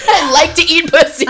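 loud and high, cracking up mid-line; laughing shout. Real, not acted. Telling her co-host the spicy detail, laughing loudly. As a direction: blurt it out through laughter. Giggly punchline about the book.

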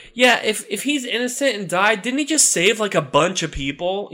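high, anxious underling asking; worried minion. Acted. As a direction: point out the bad news nervously. Demon realizes the problem.

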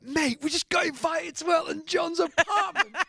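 shouting way up high, half laughing; excited shout. Retold or imitated. Telling the boys about [name]'s invite, an excited high shout. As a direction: yell the news to your bandmates.

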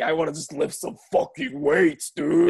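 mock a friend buzzing on pre-workout; amped-up bro voice, pitched high; hyped-up gym bro.

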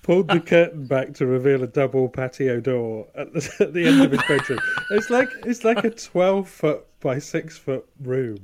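can barely get it out for laughing. Real, not acted. Laughing while telling dad story.

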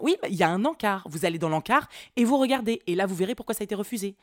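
imitating a worked-up clerk, loud and hammering; loud, exasperated mock clerk rattling off orders; employée qui s'excite. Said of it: "au moment où elle s'est excitée". (imitation d'une employée excédée, voix forte)

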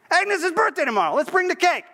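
high call to someone in the back; acted high-pitched shout across the diner. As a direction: holler to your wife in another room. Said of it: "He calls to his wife who's in the back".

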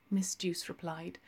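ordinary delivery, nothing marked

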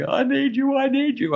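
put-on high pleading child voice; needy child. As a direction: whine it like a small kid. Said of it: "our kids will be like".

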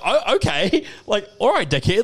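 high-pitched, laughing retort; laughing disbelief. Retold or imitated. Retelling his reply to a rude salesman, laughing in disbelief. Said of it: "I was, like, laughing".

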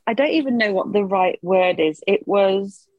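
ordinary talk, nothing marked

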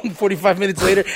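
ordinary delivery

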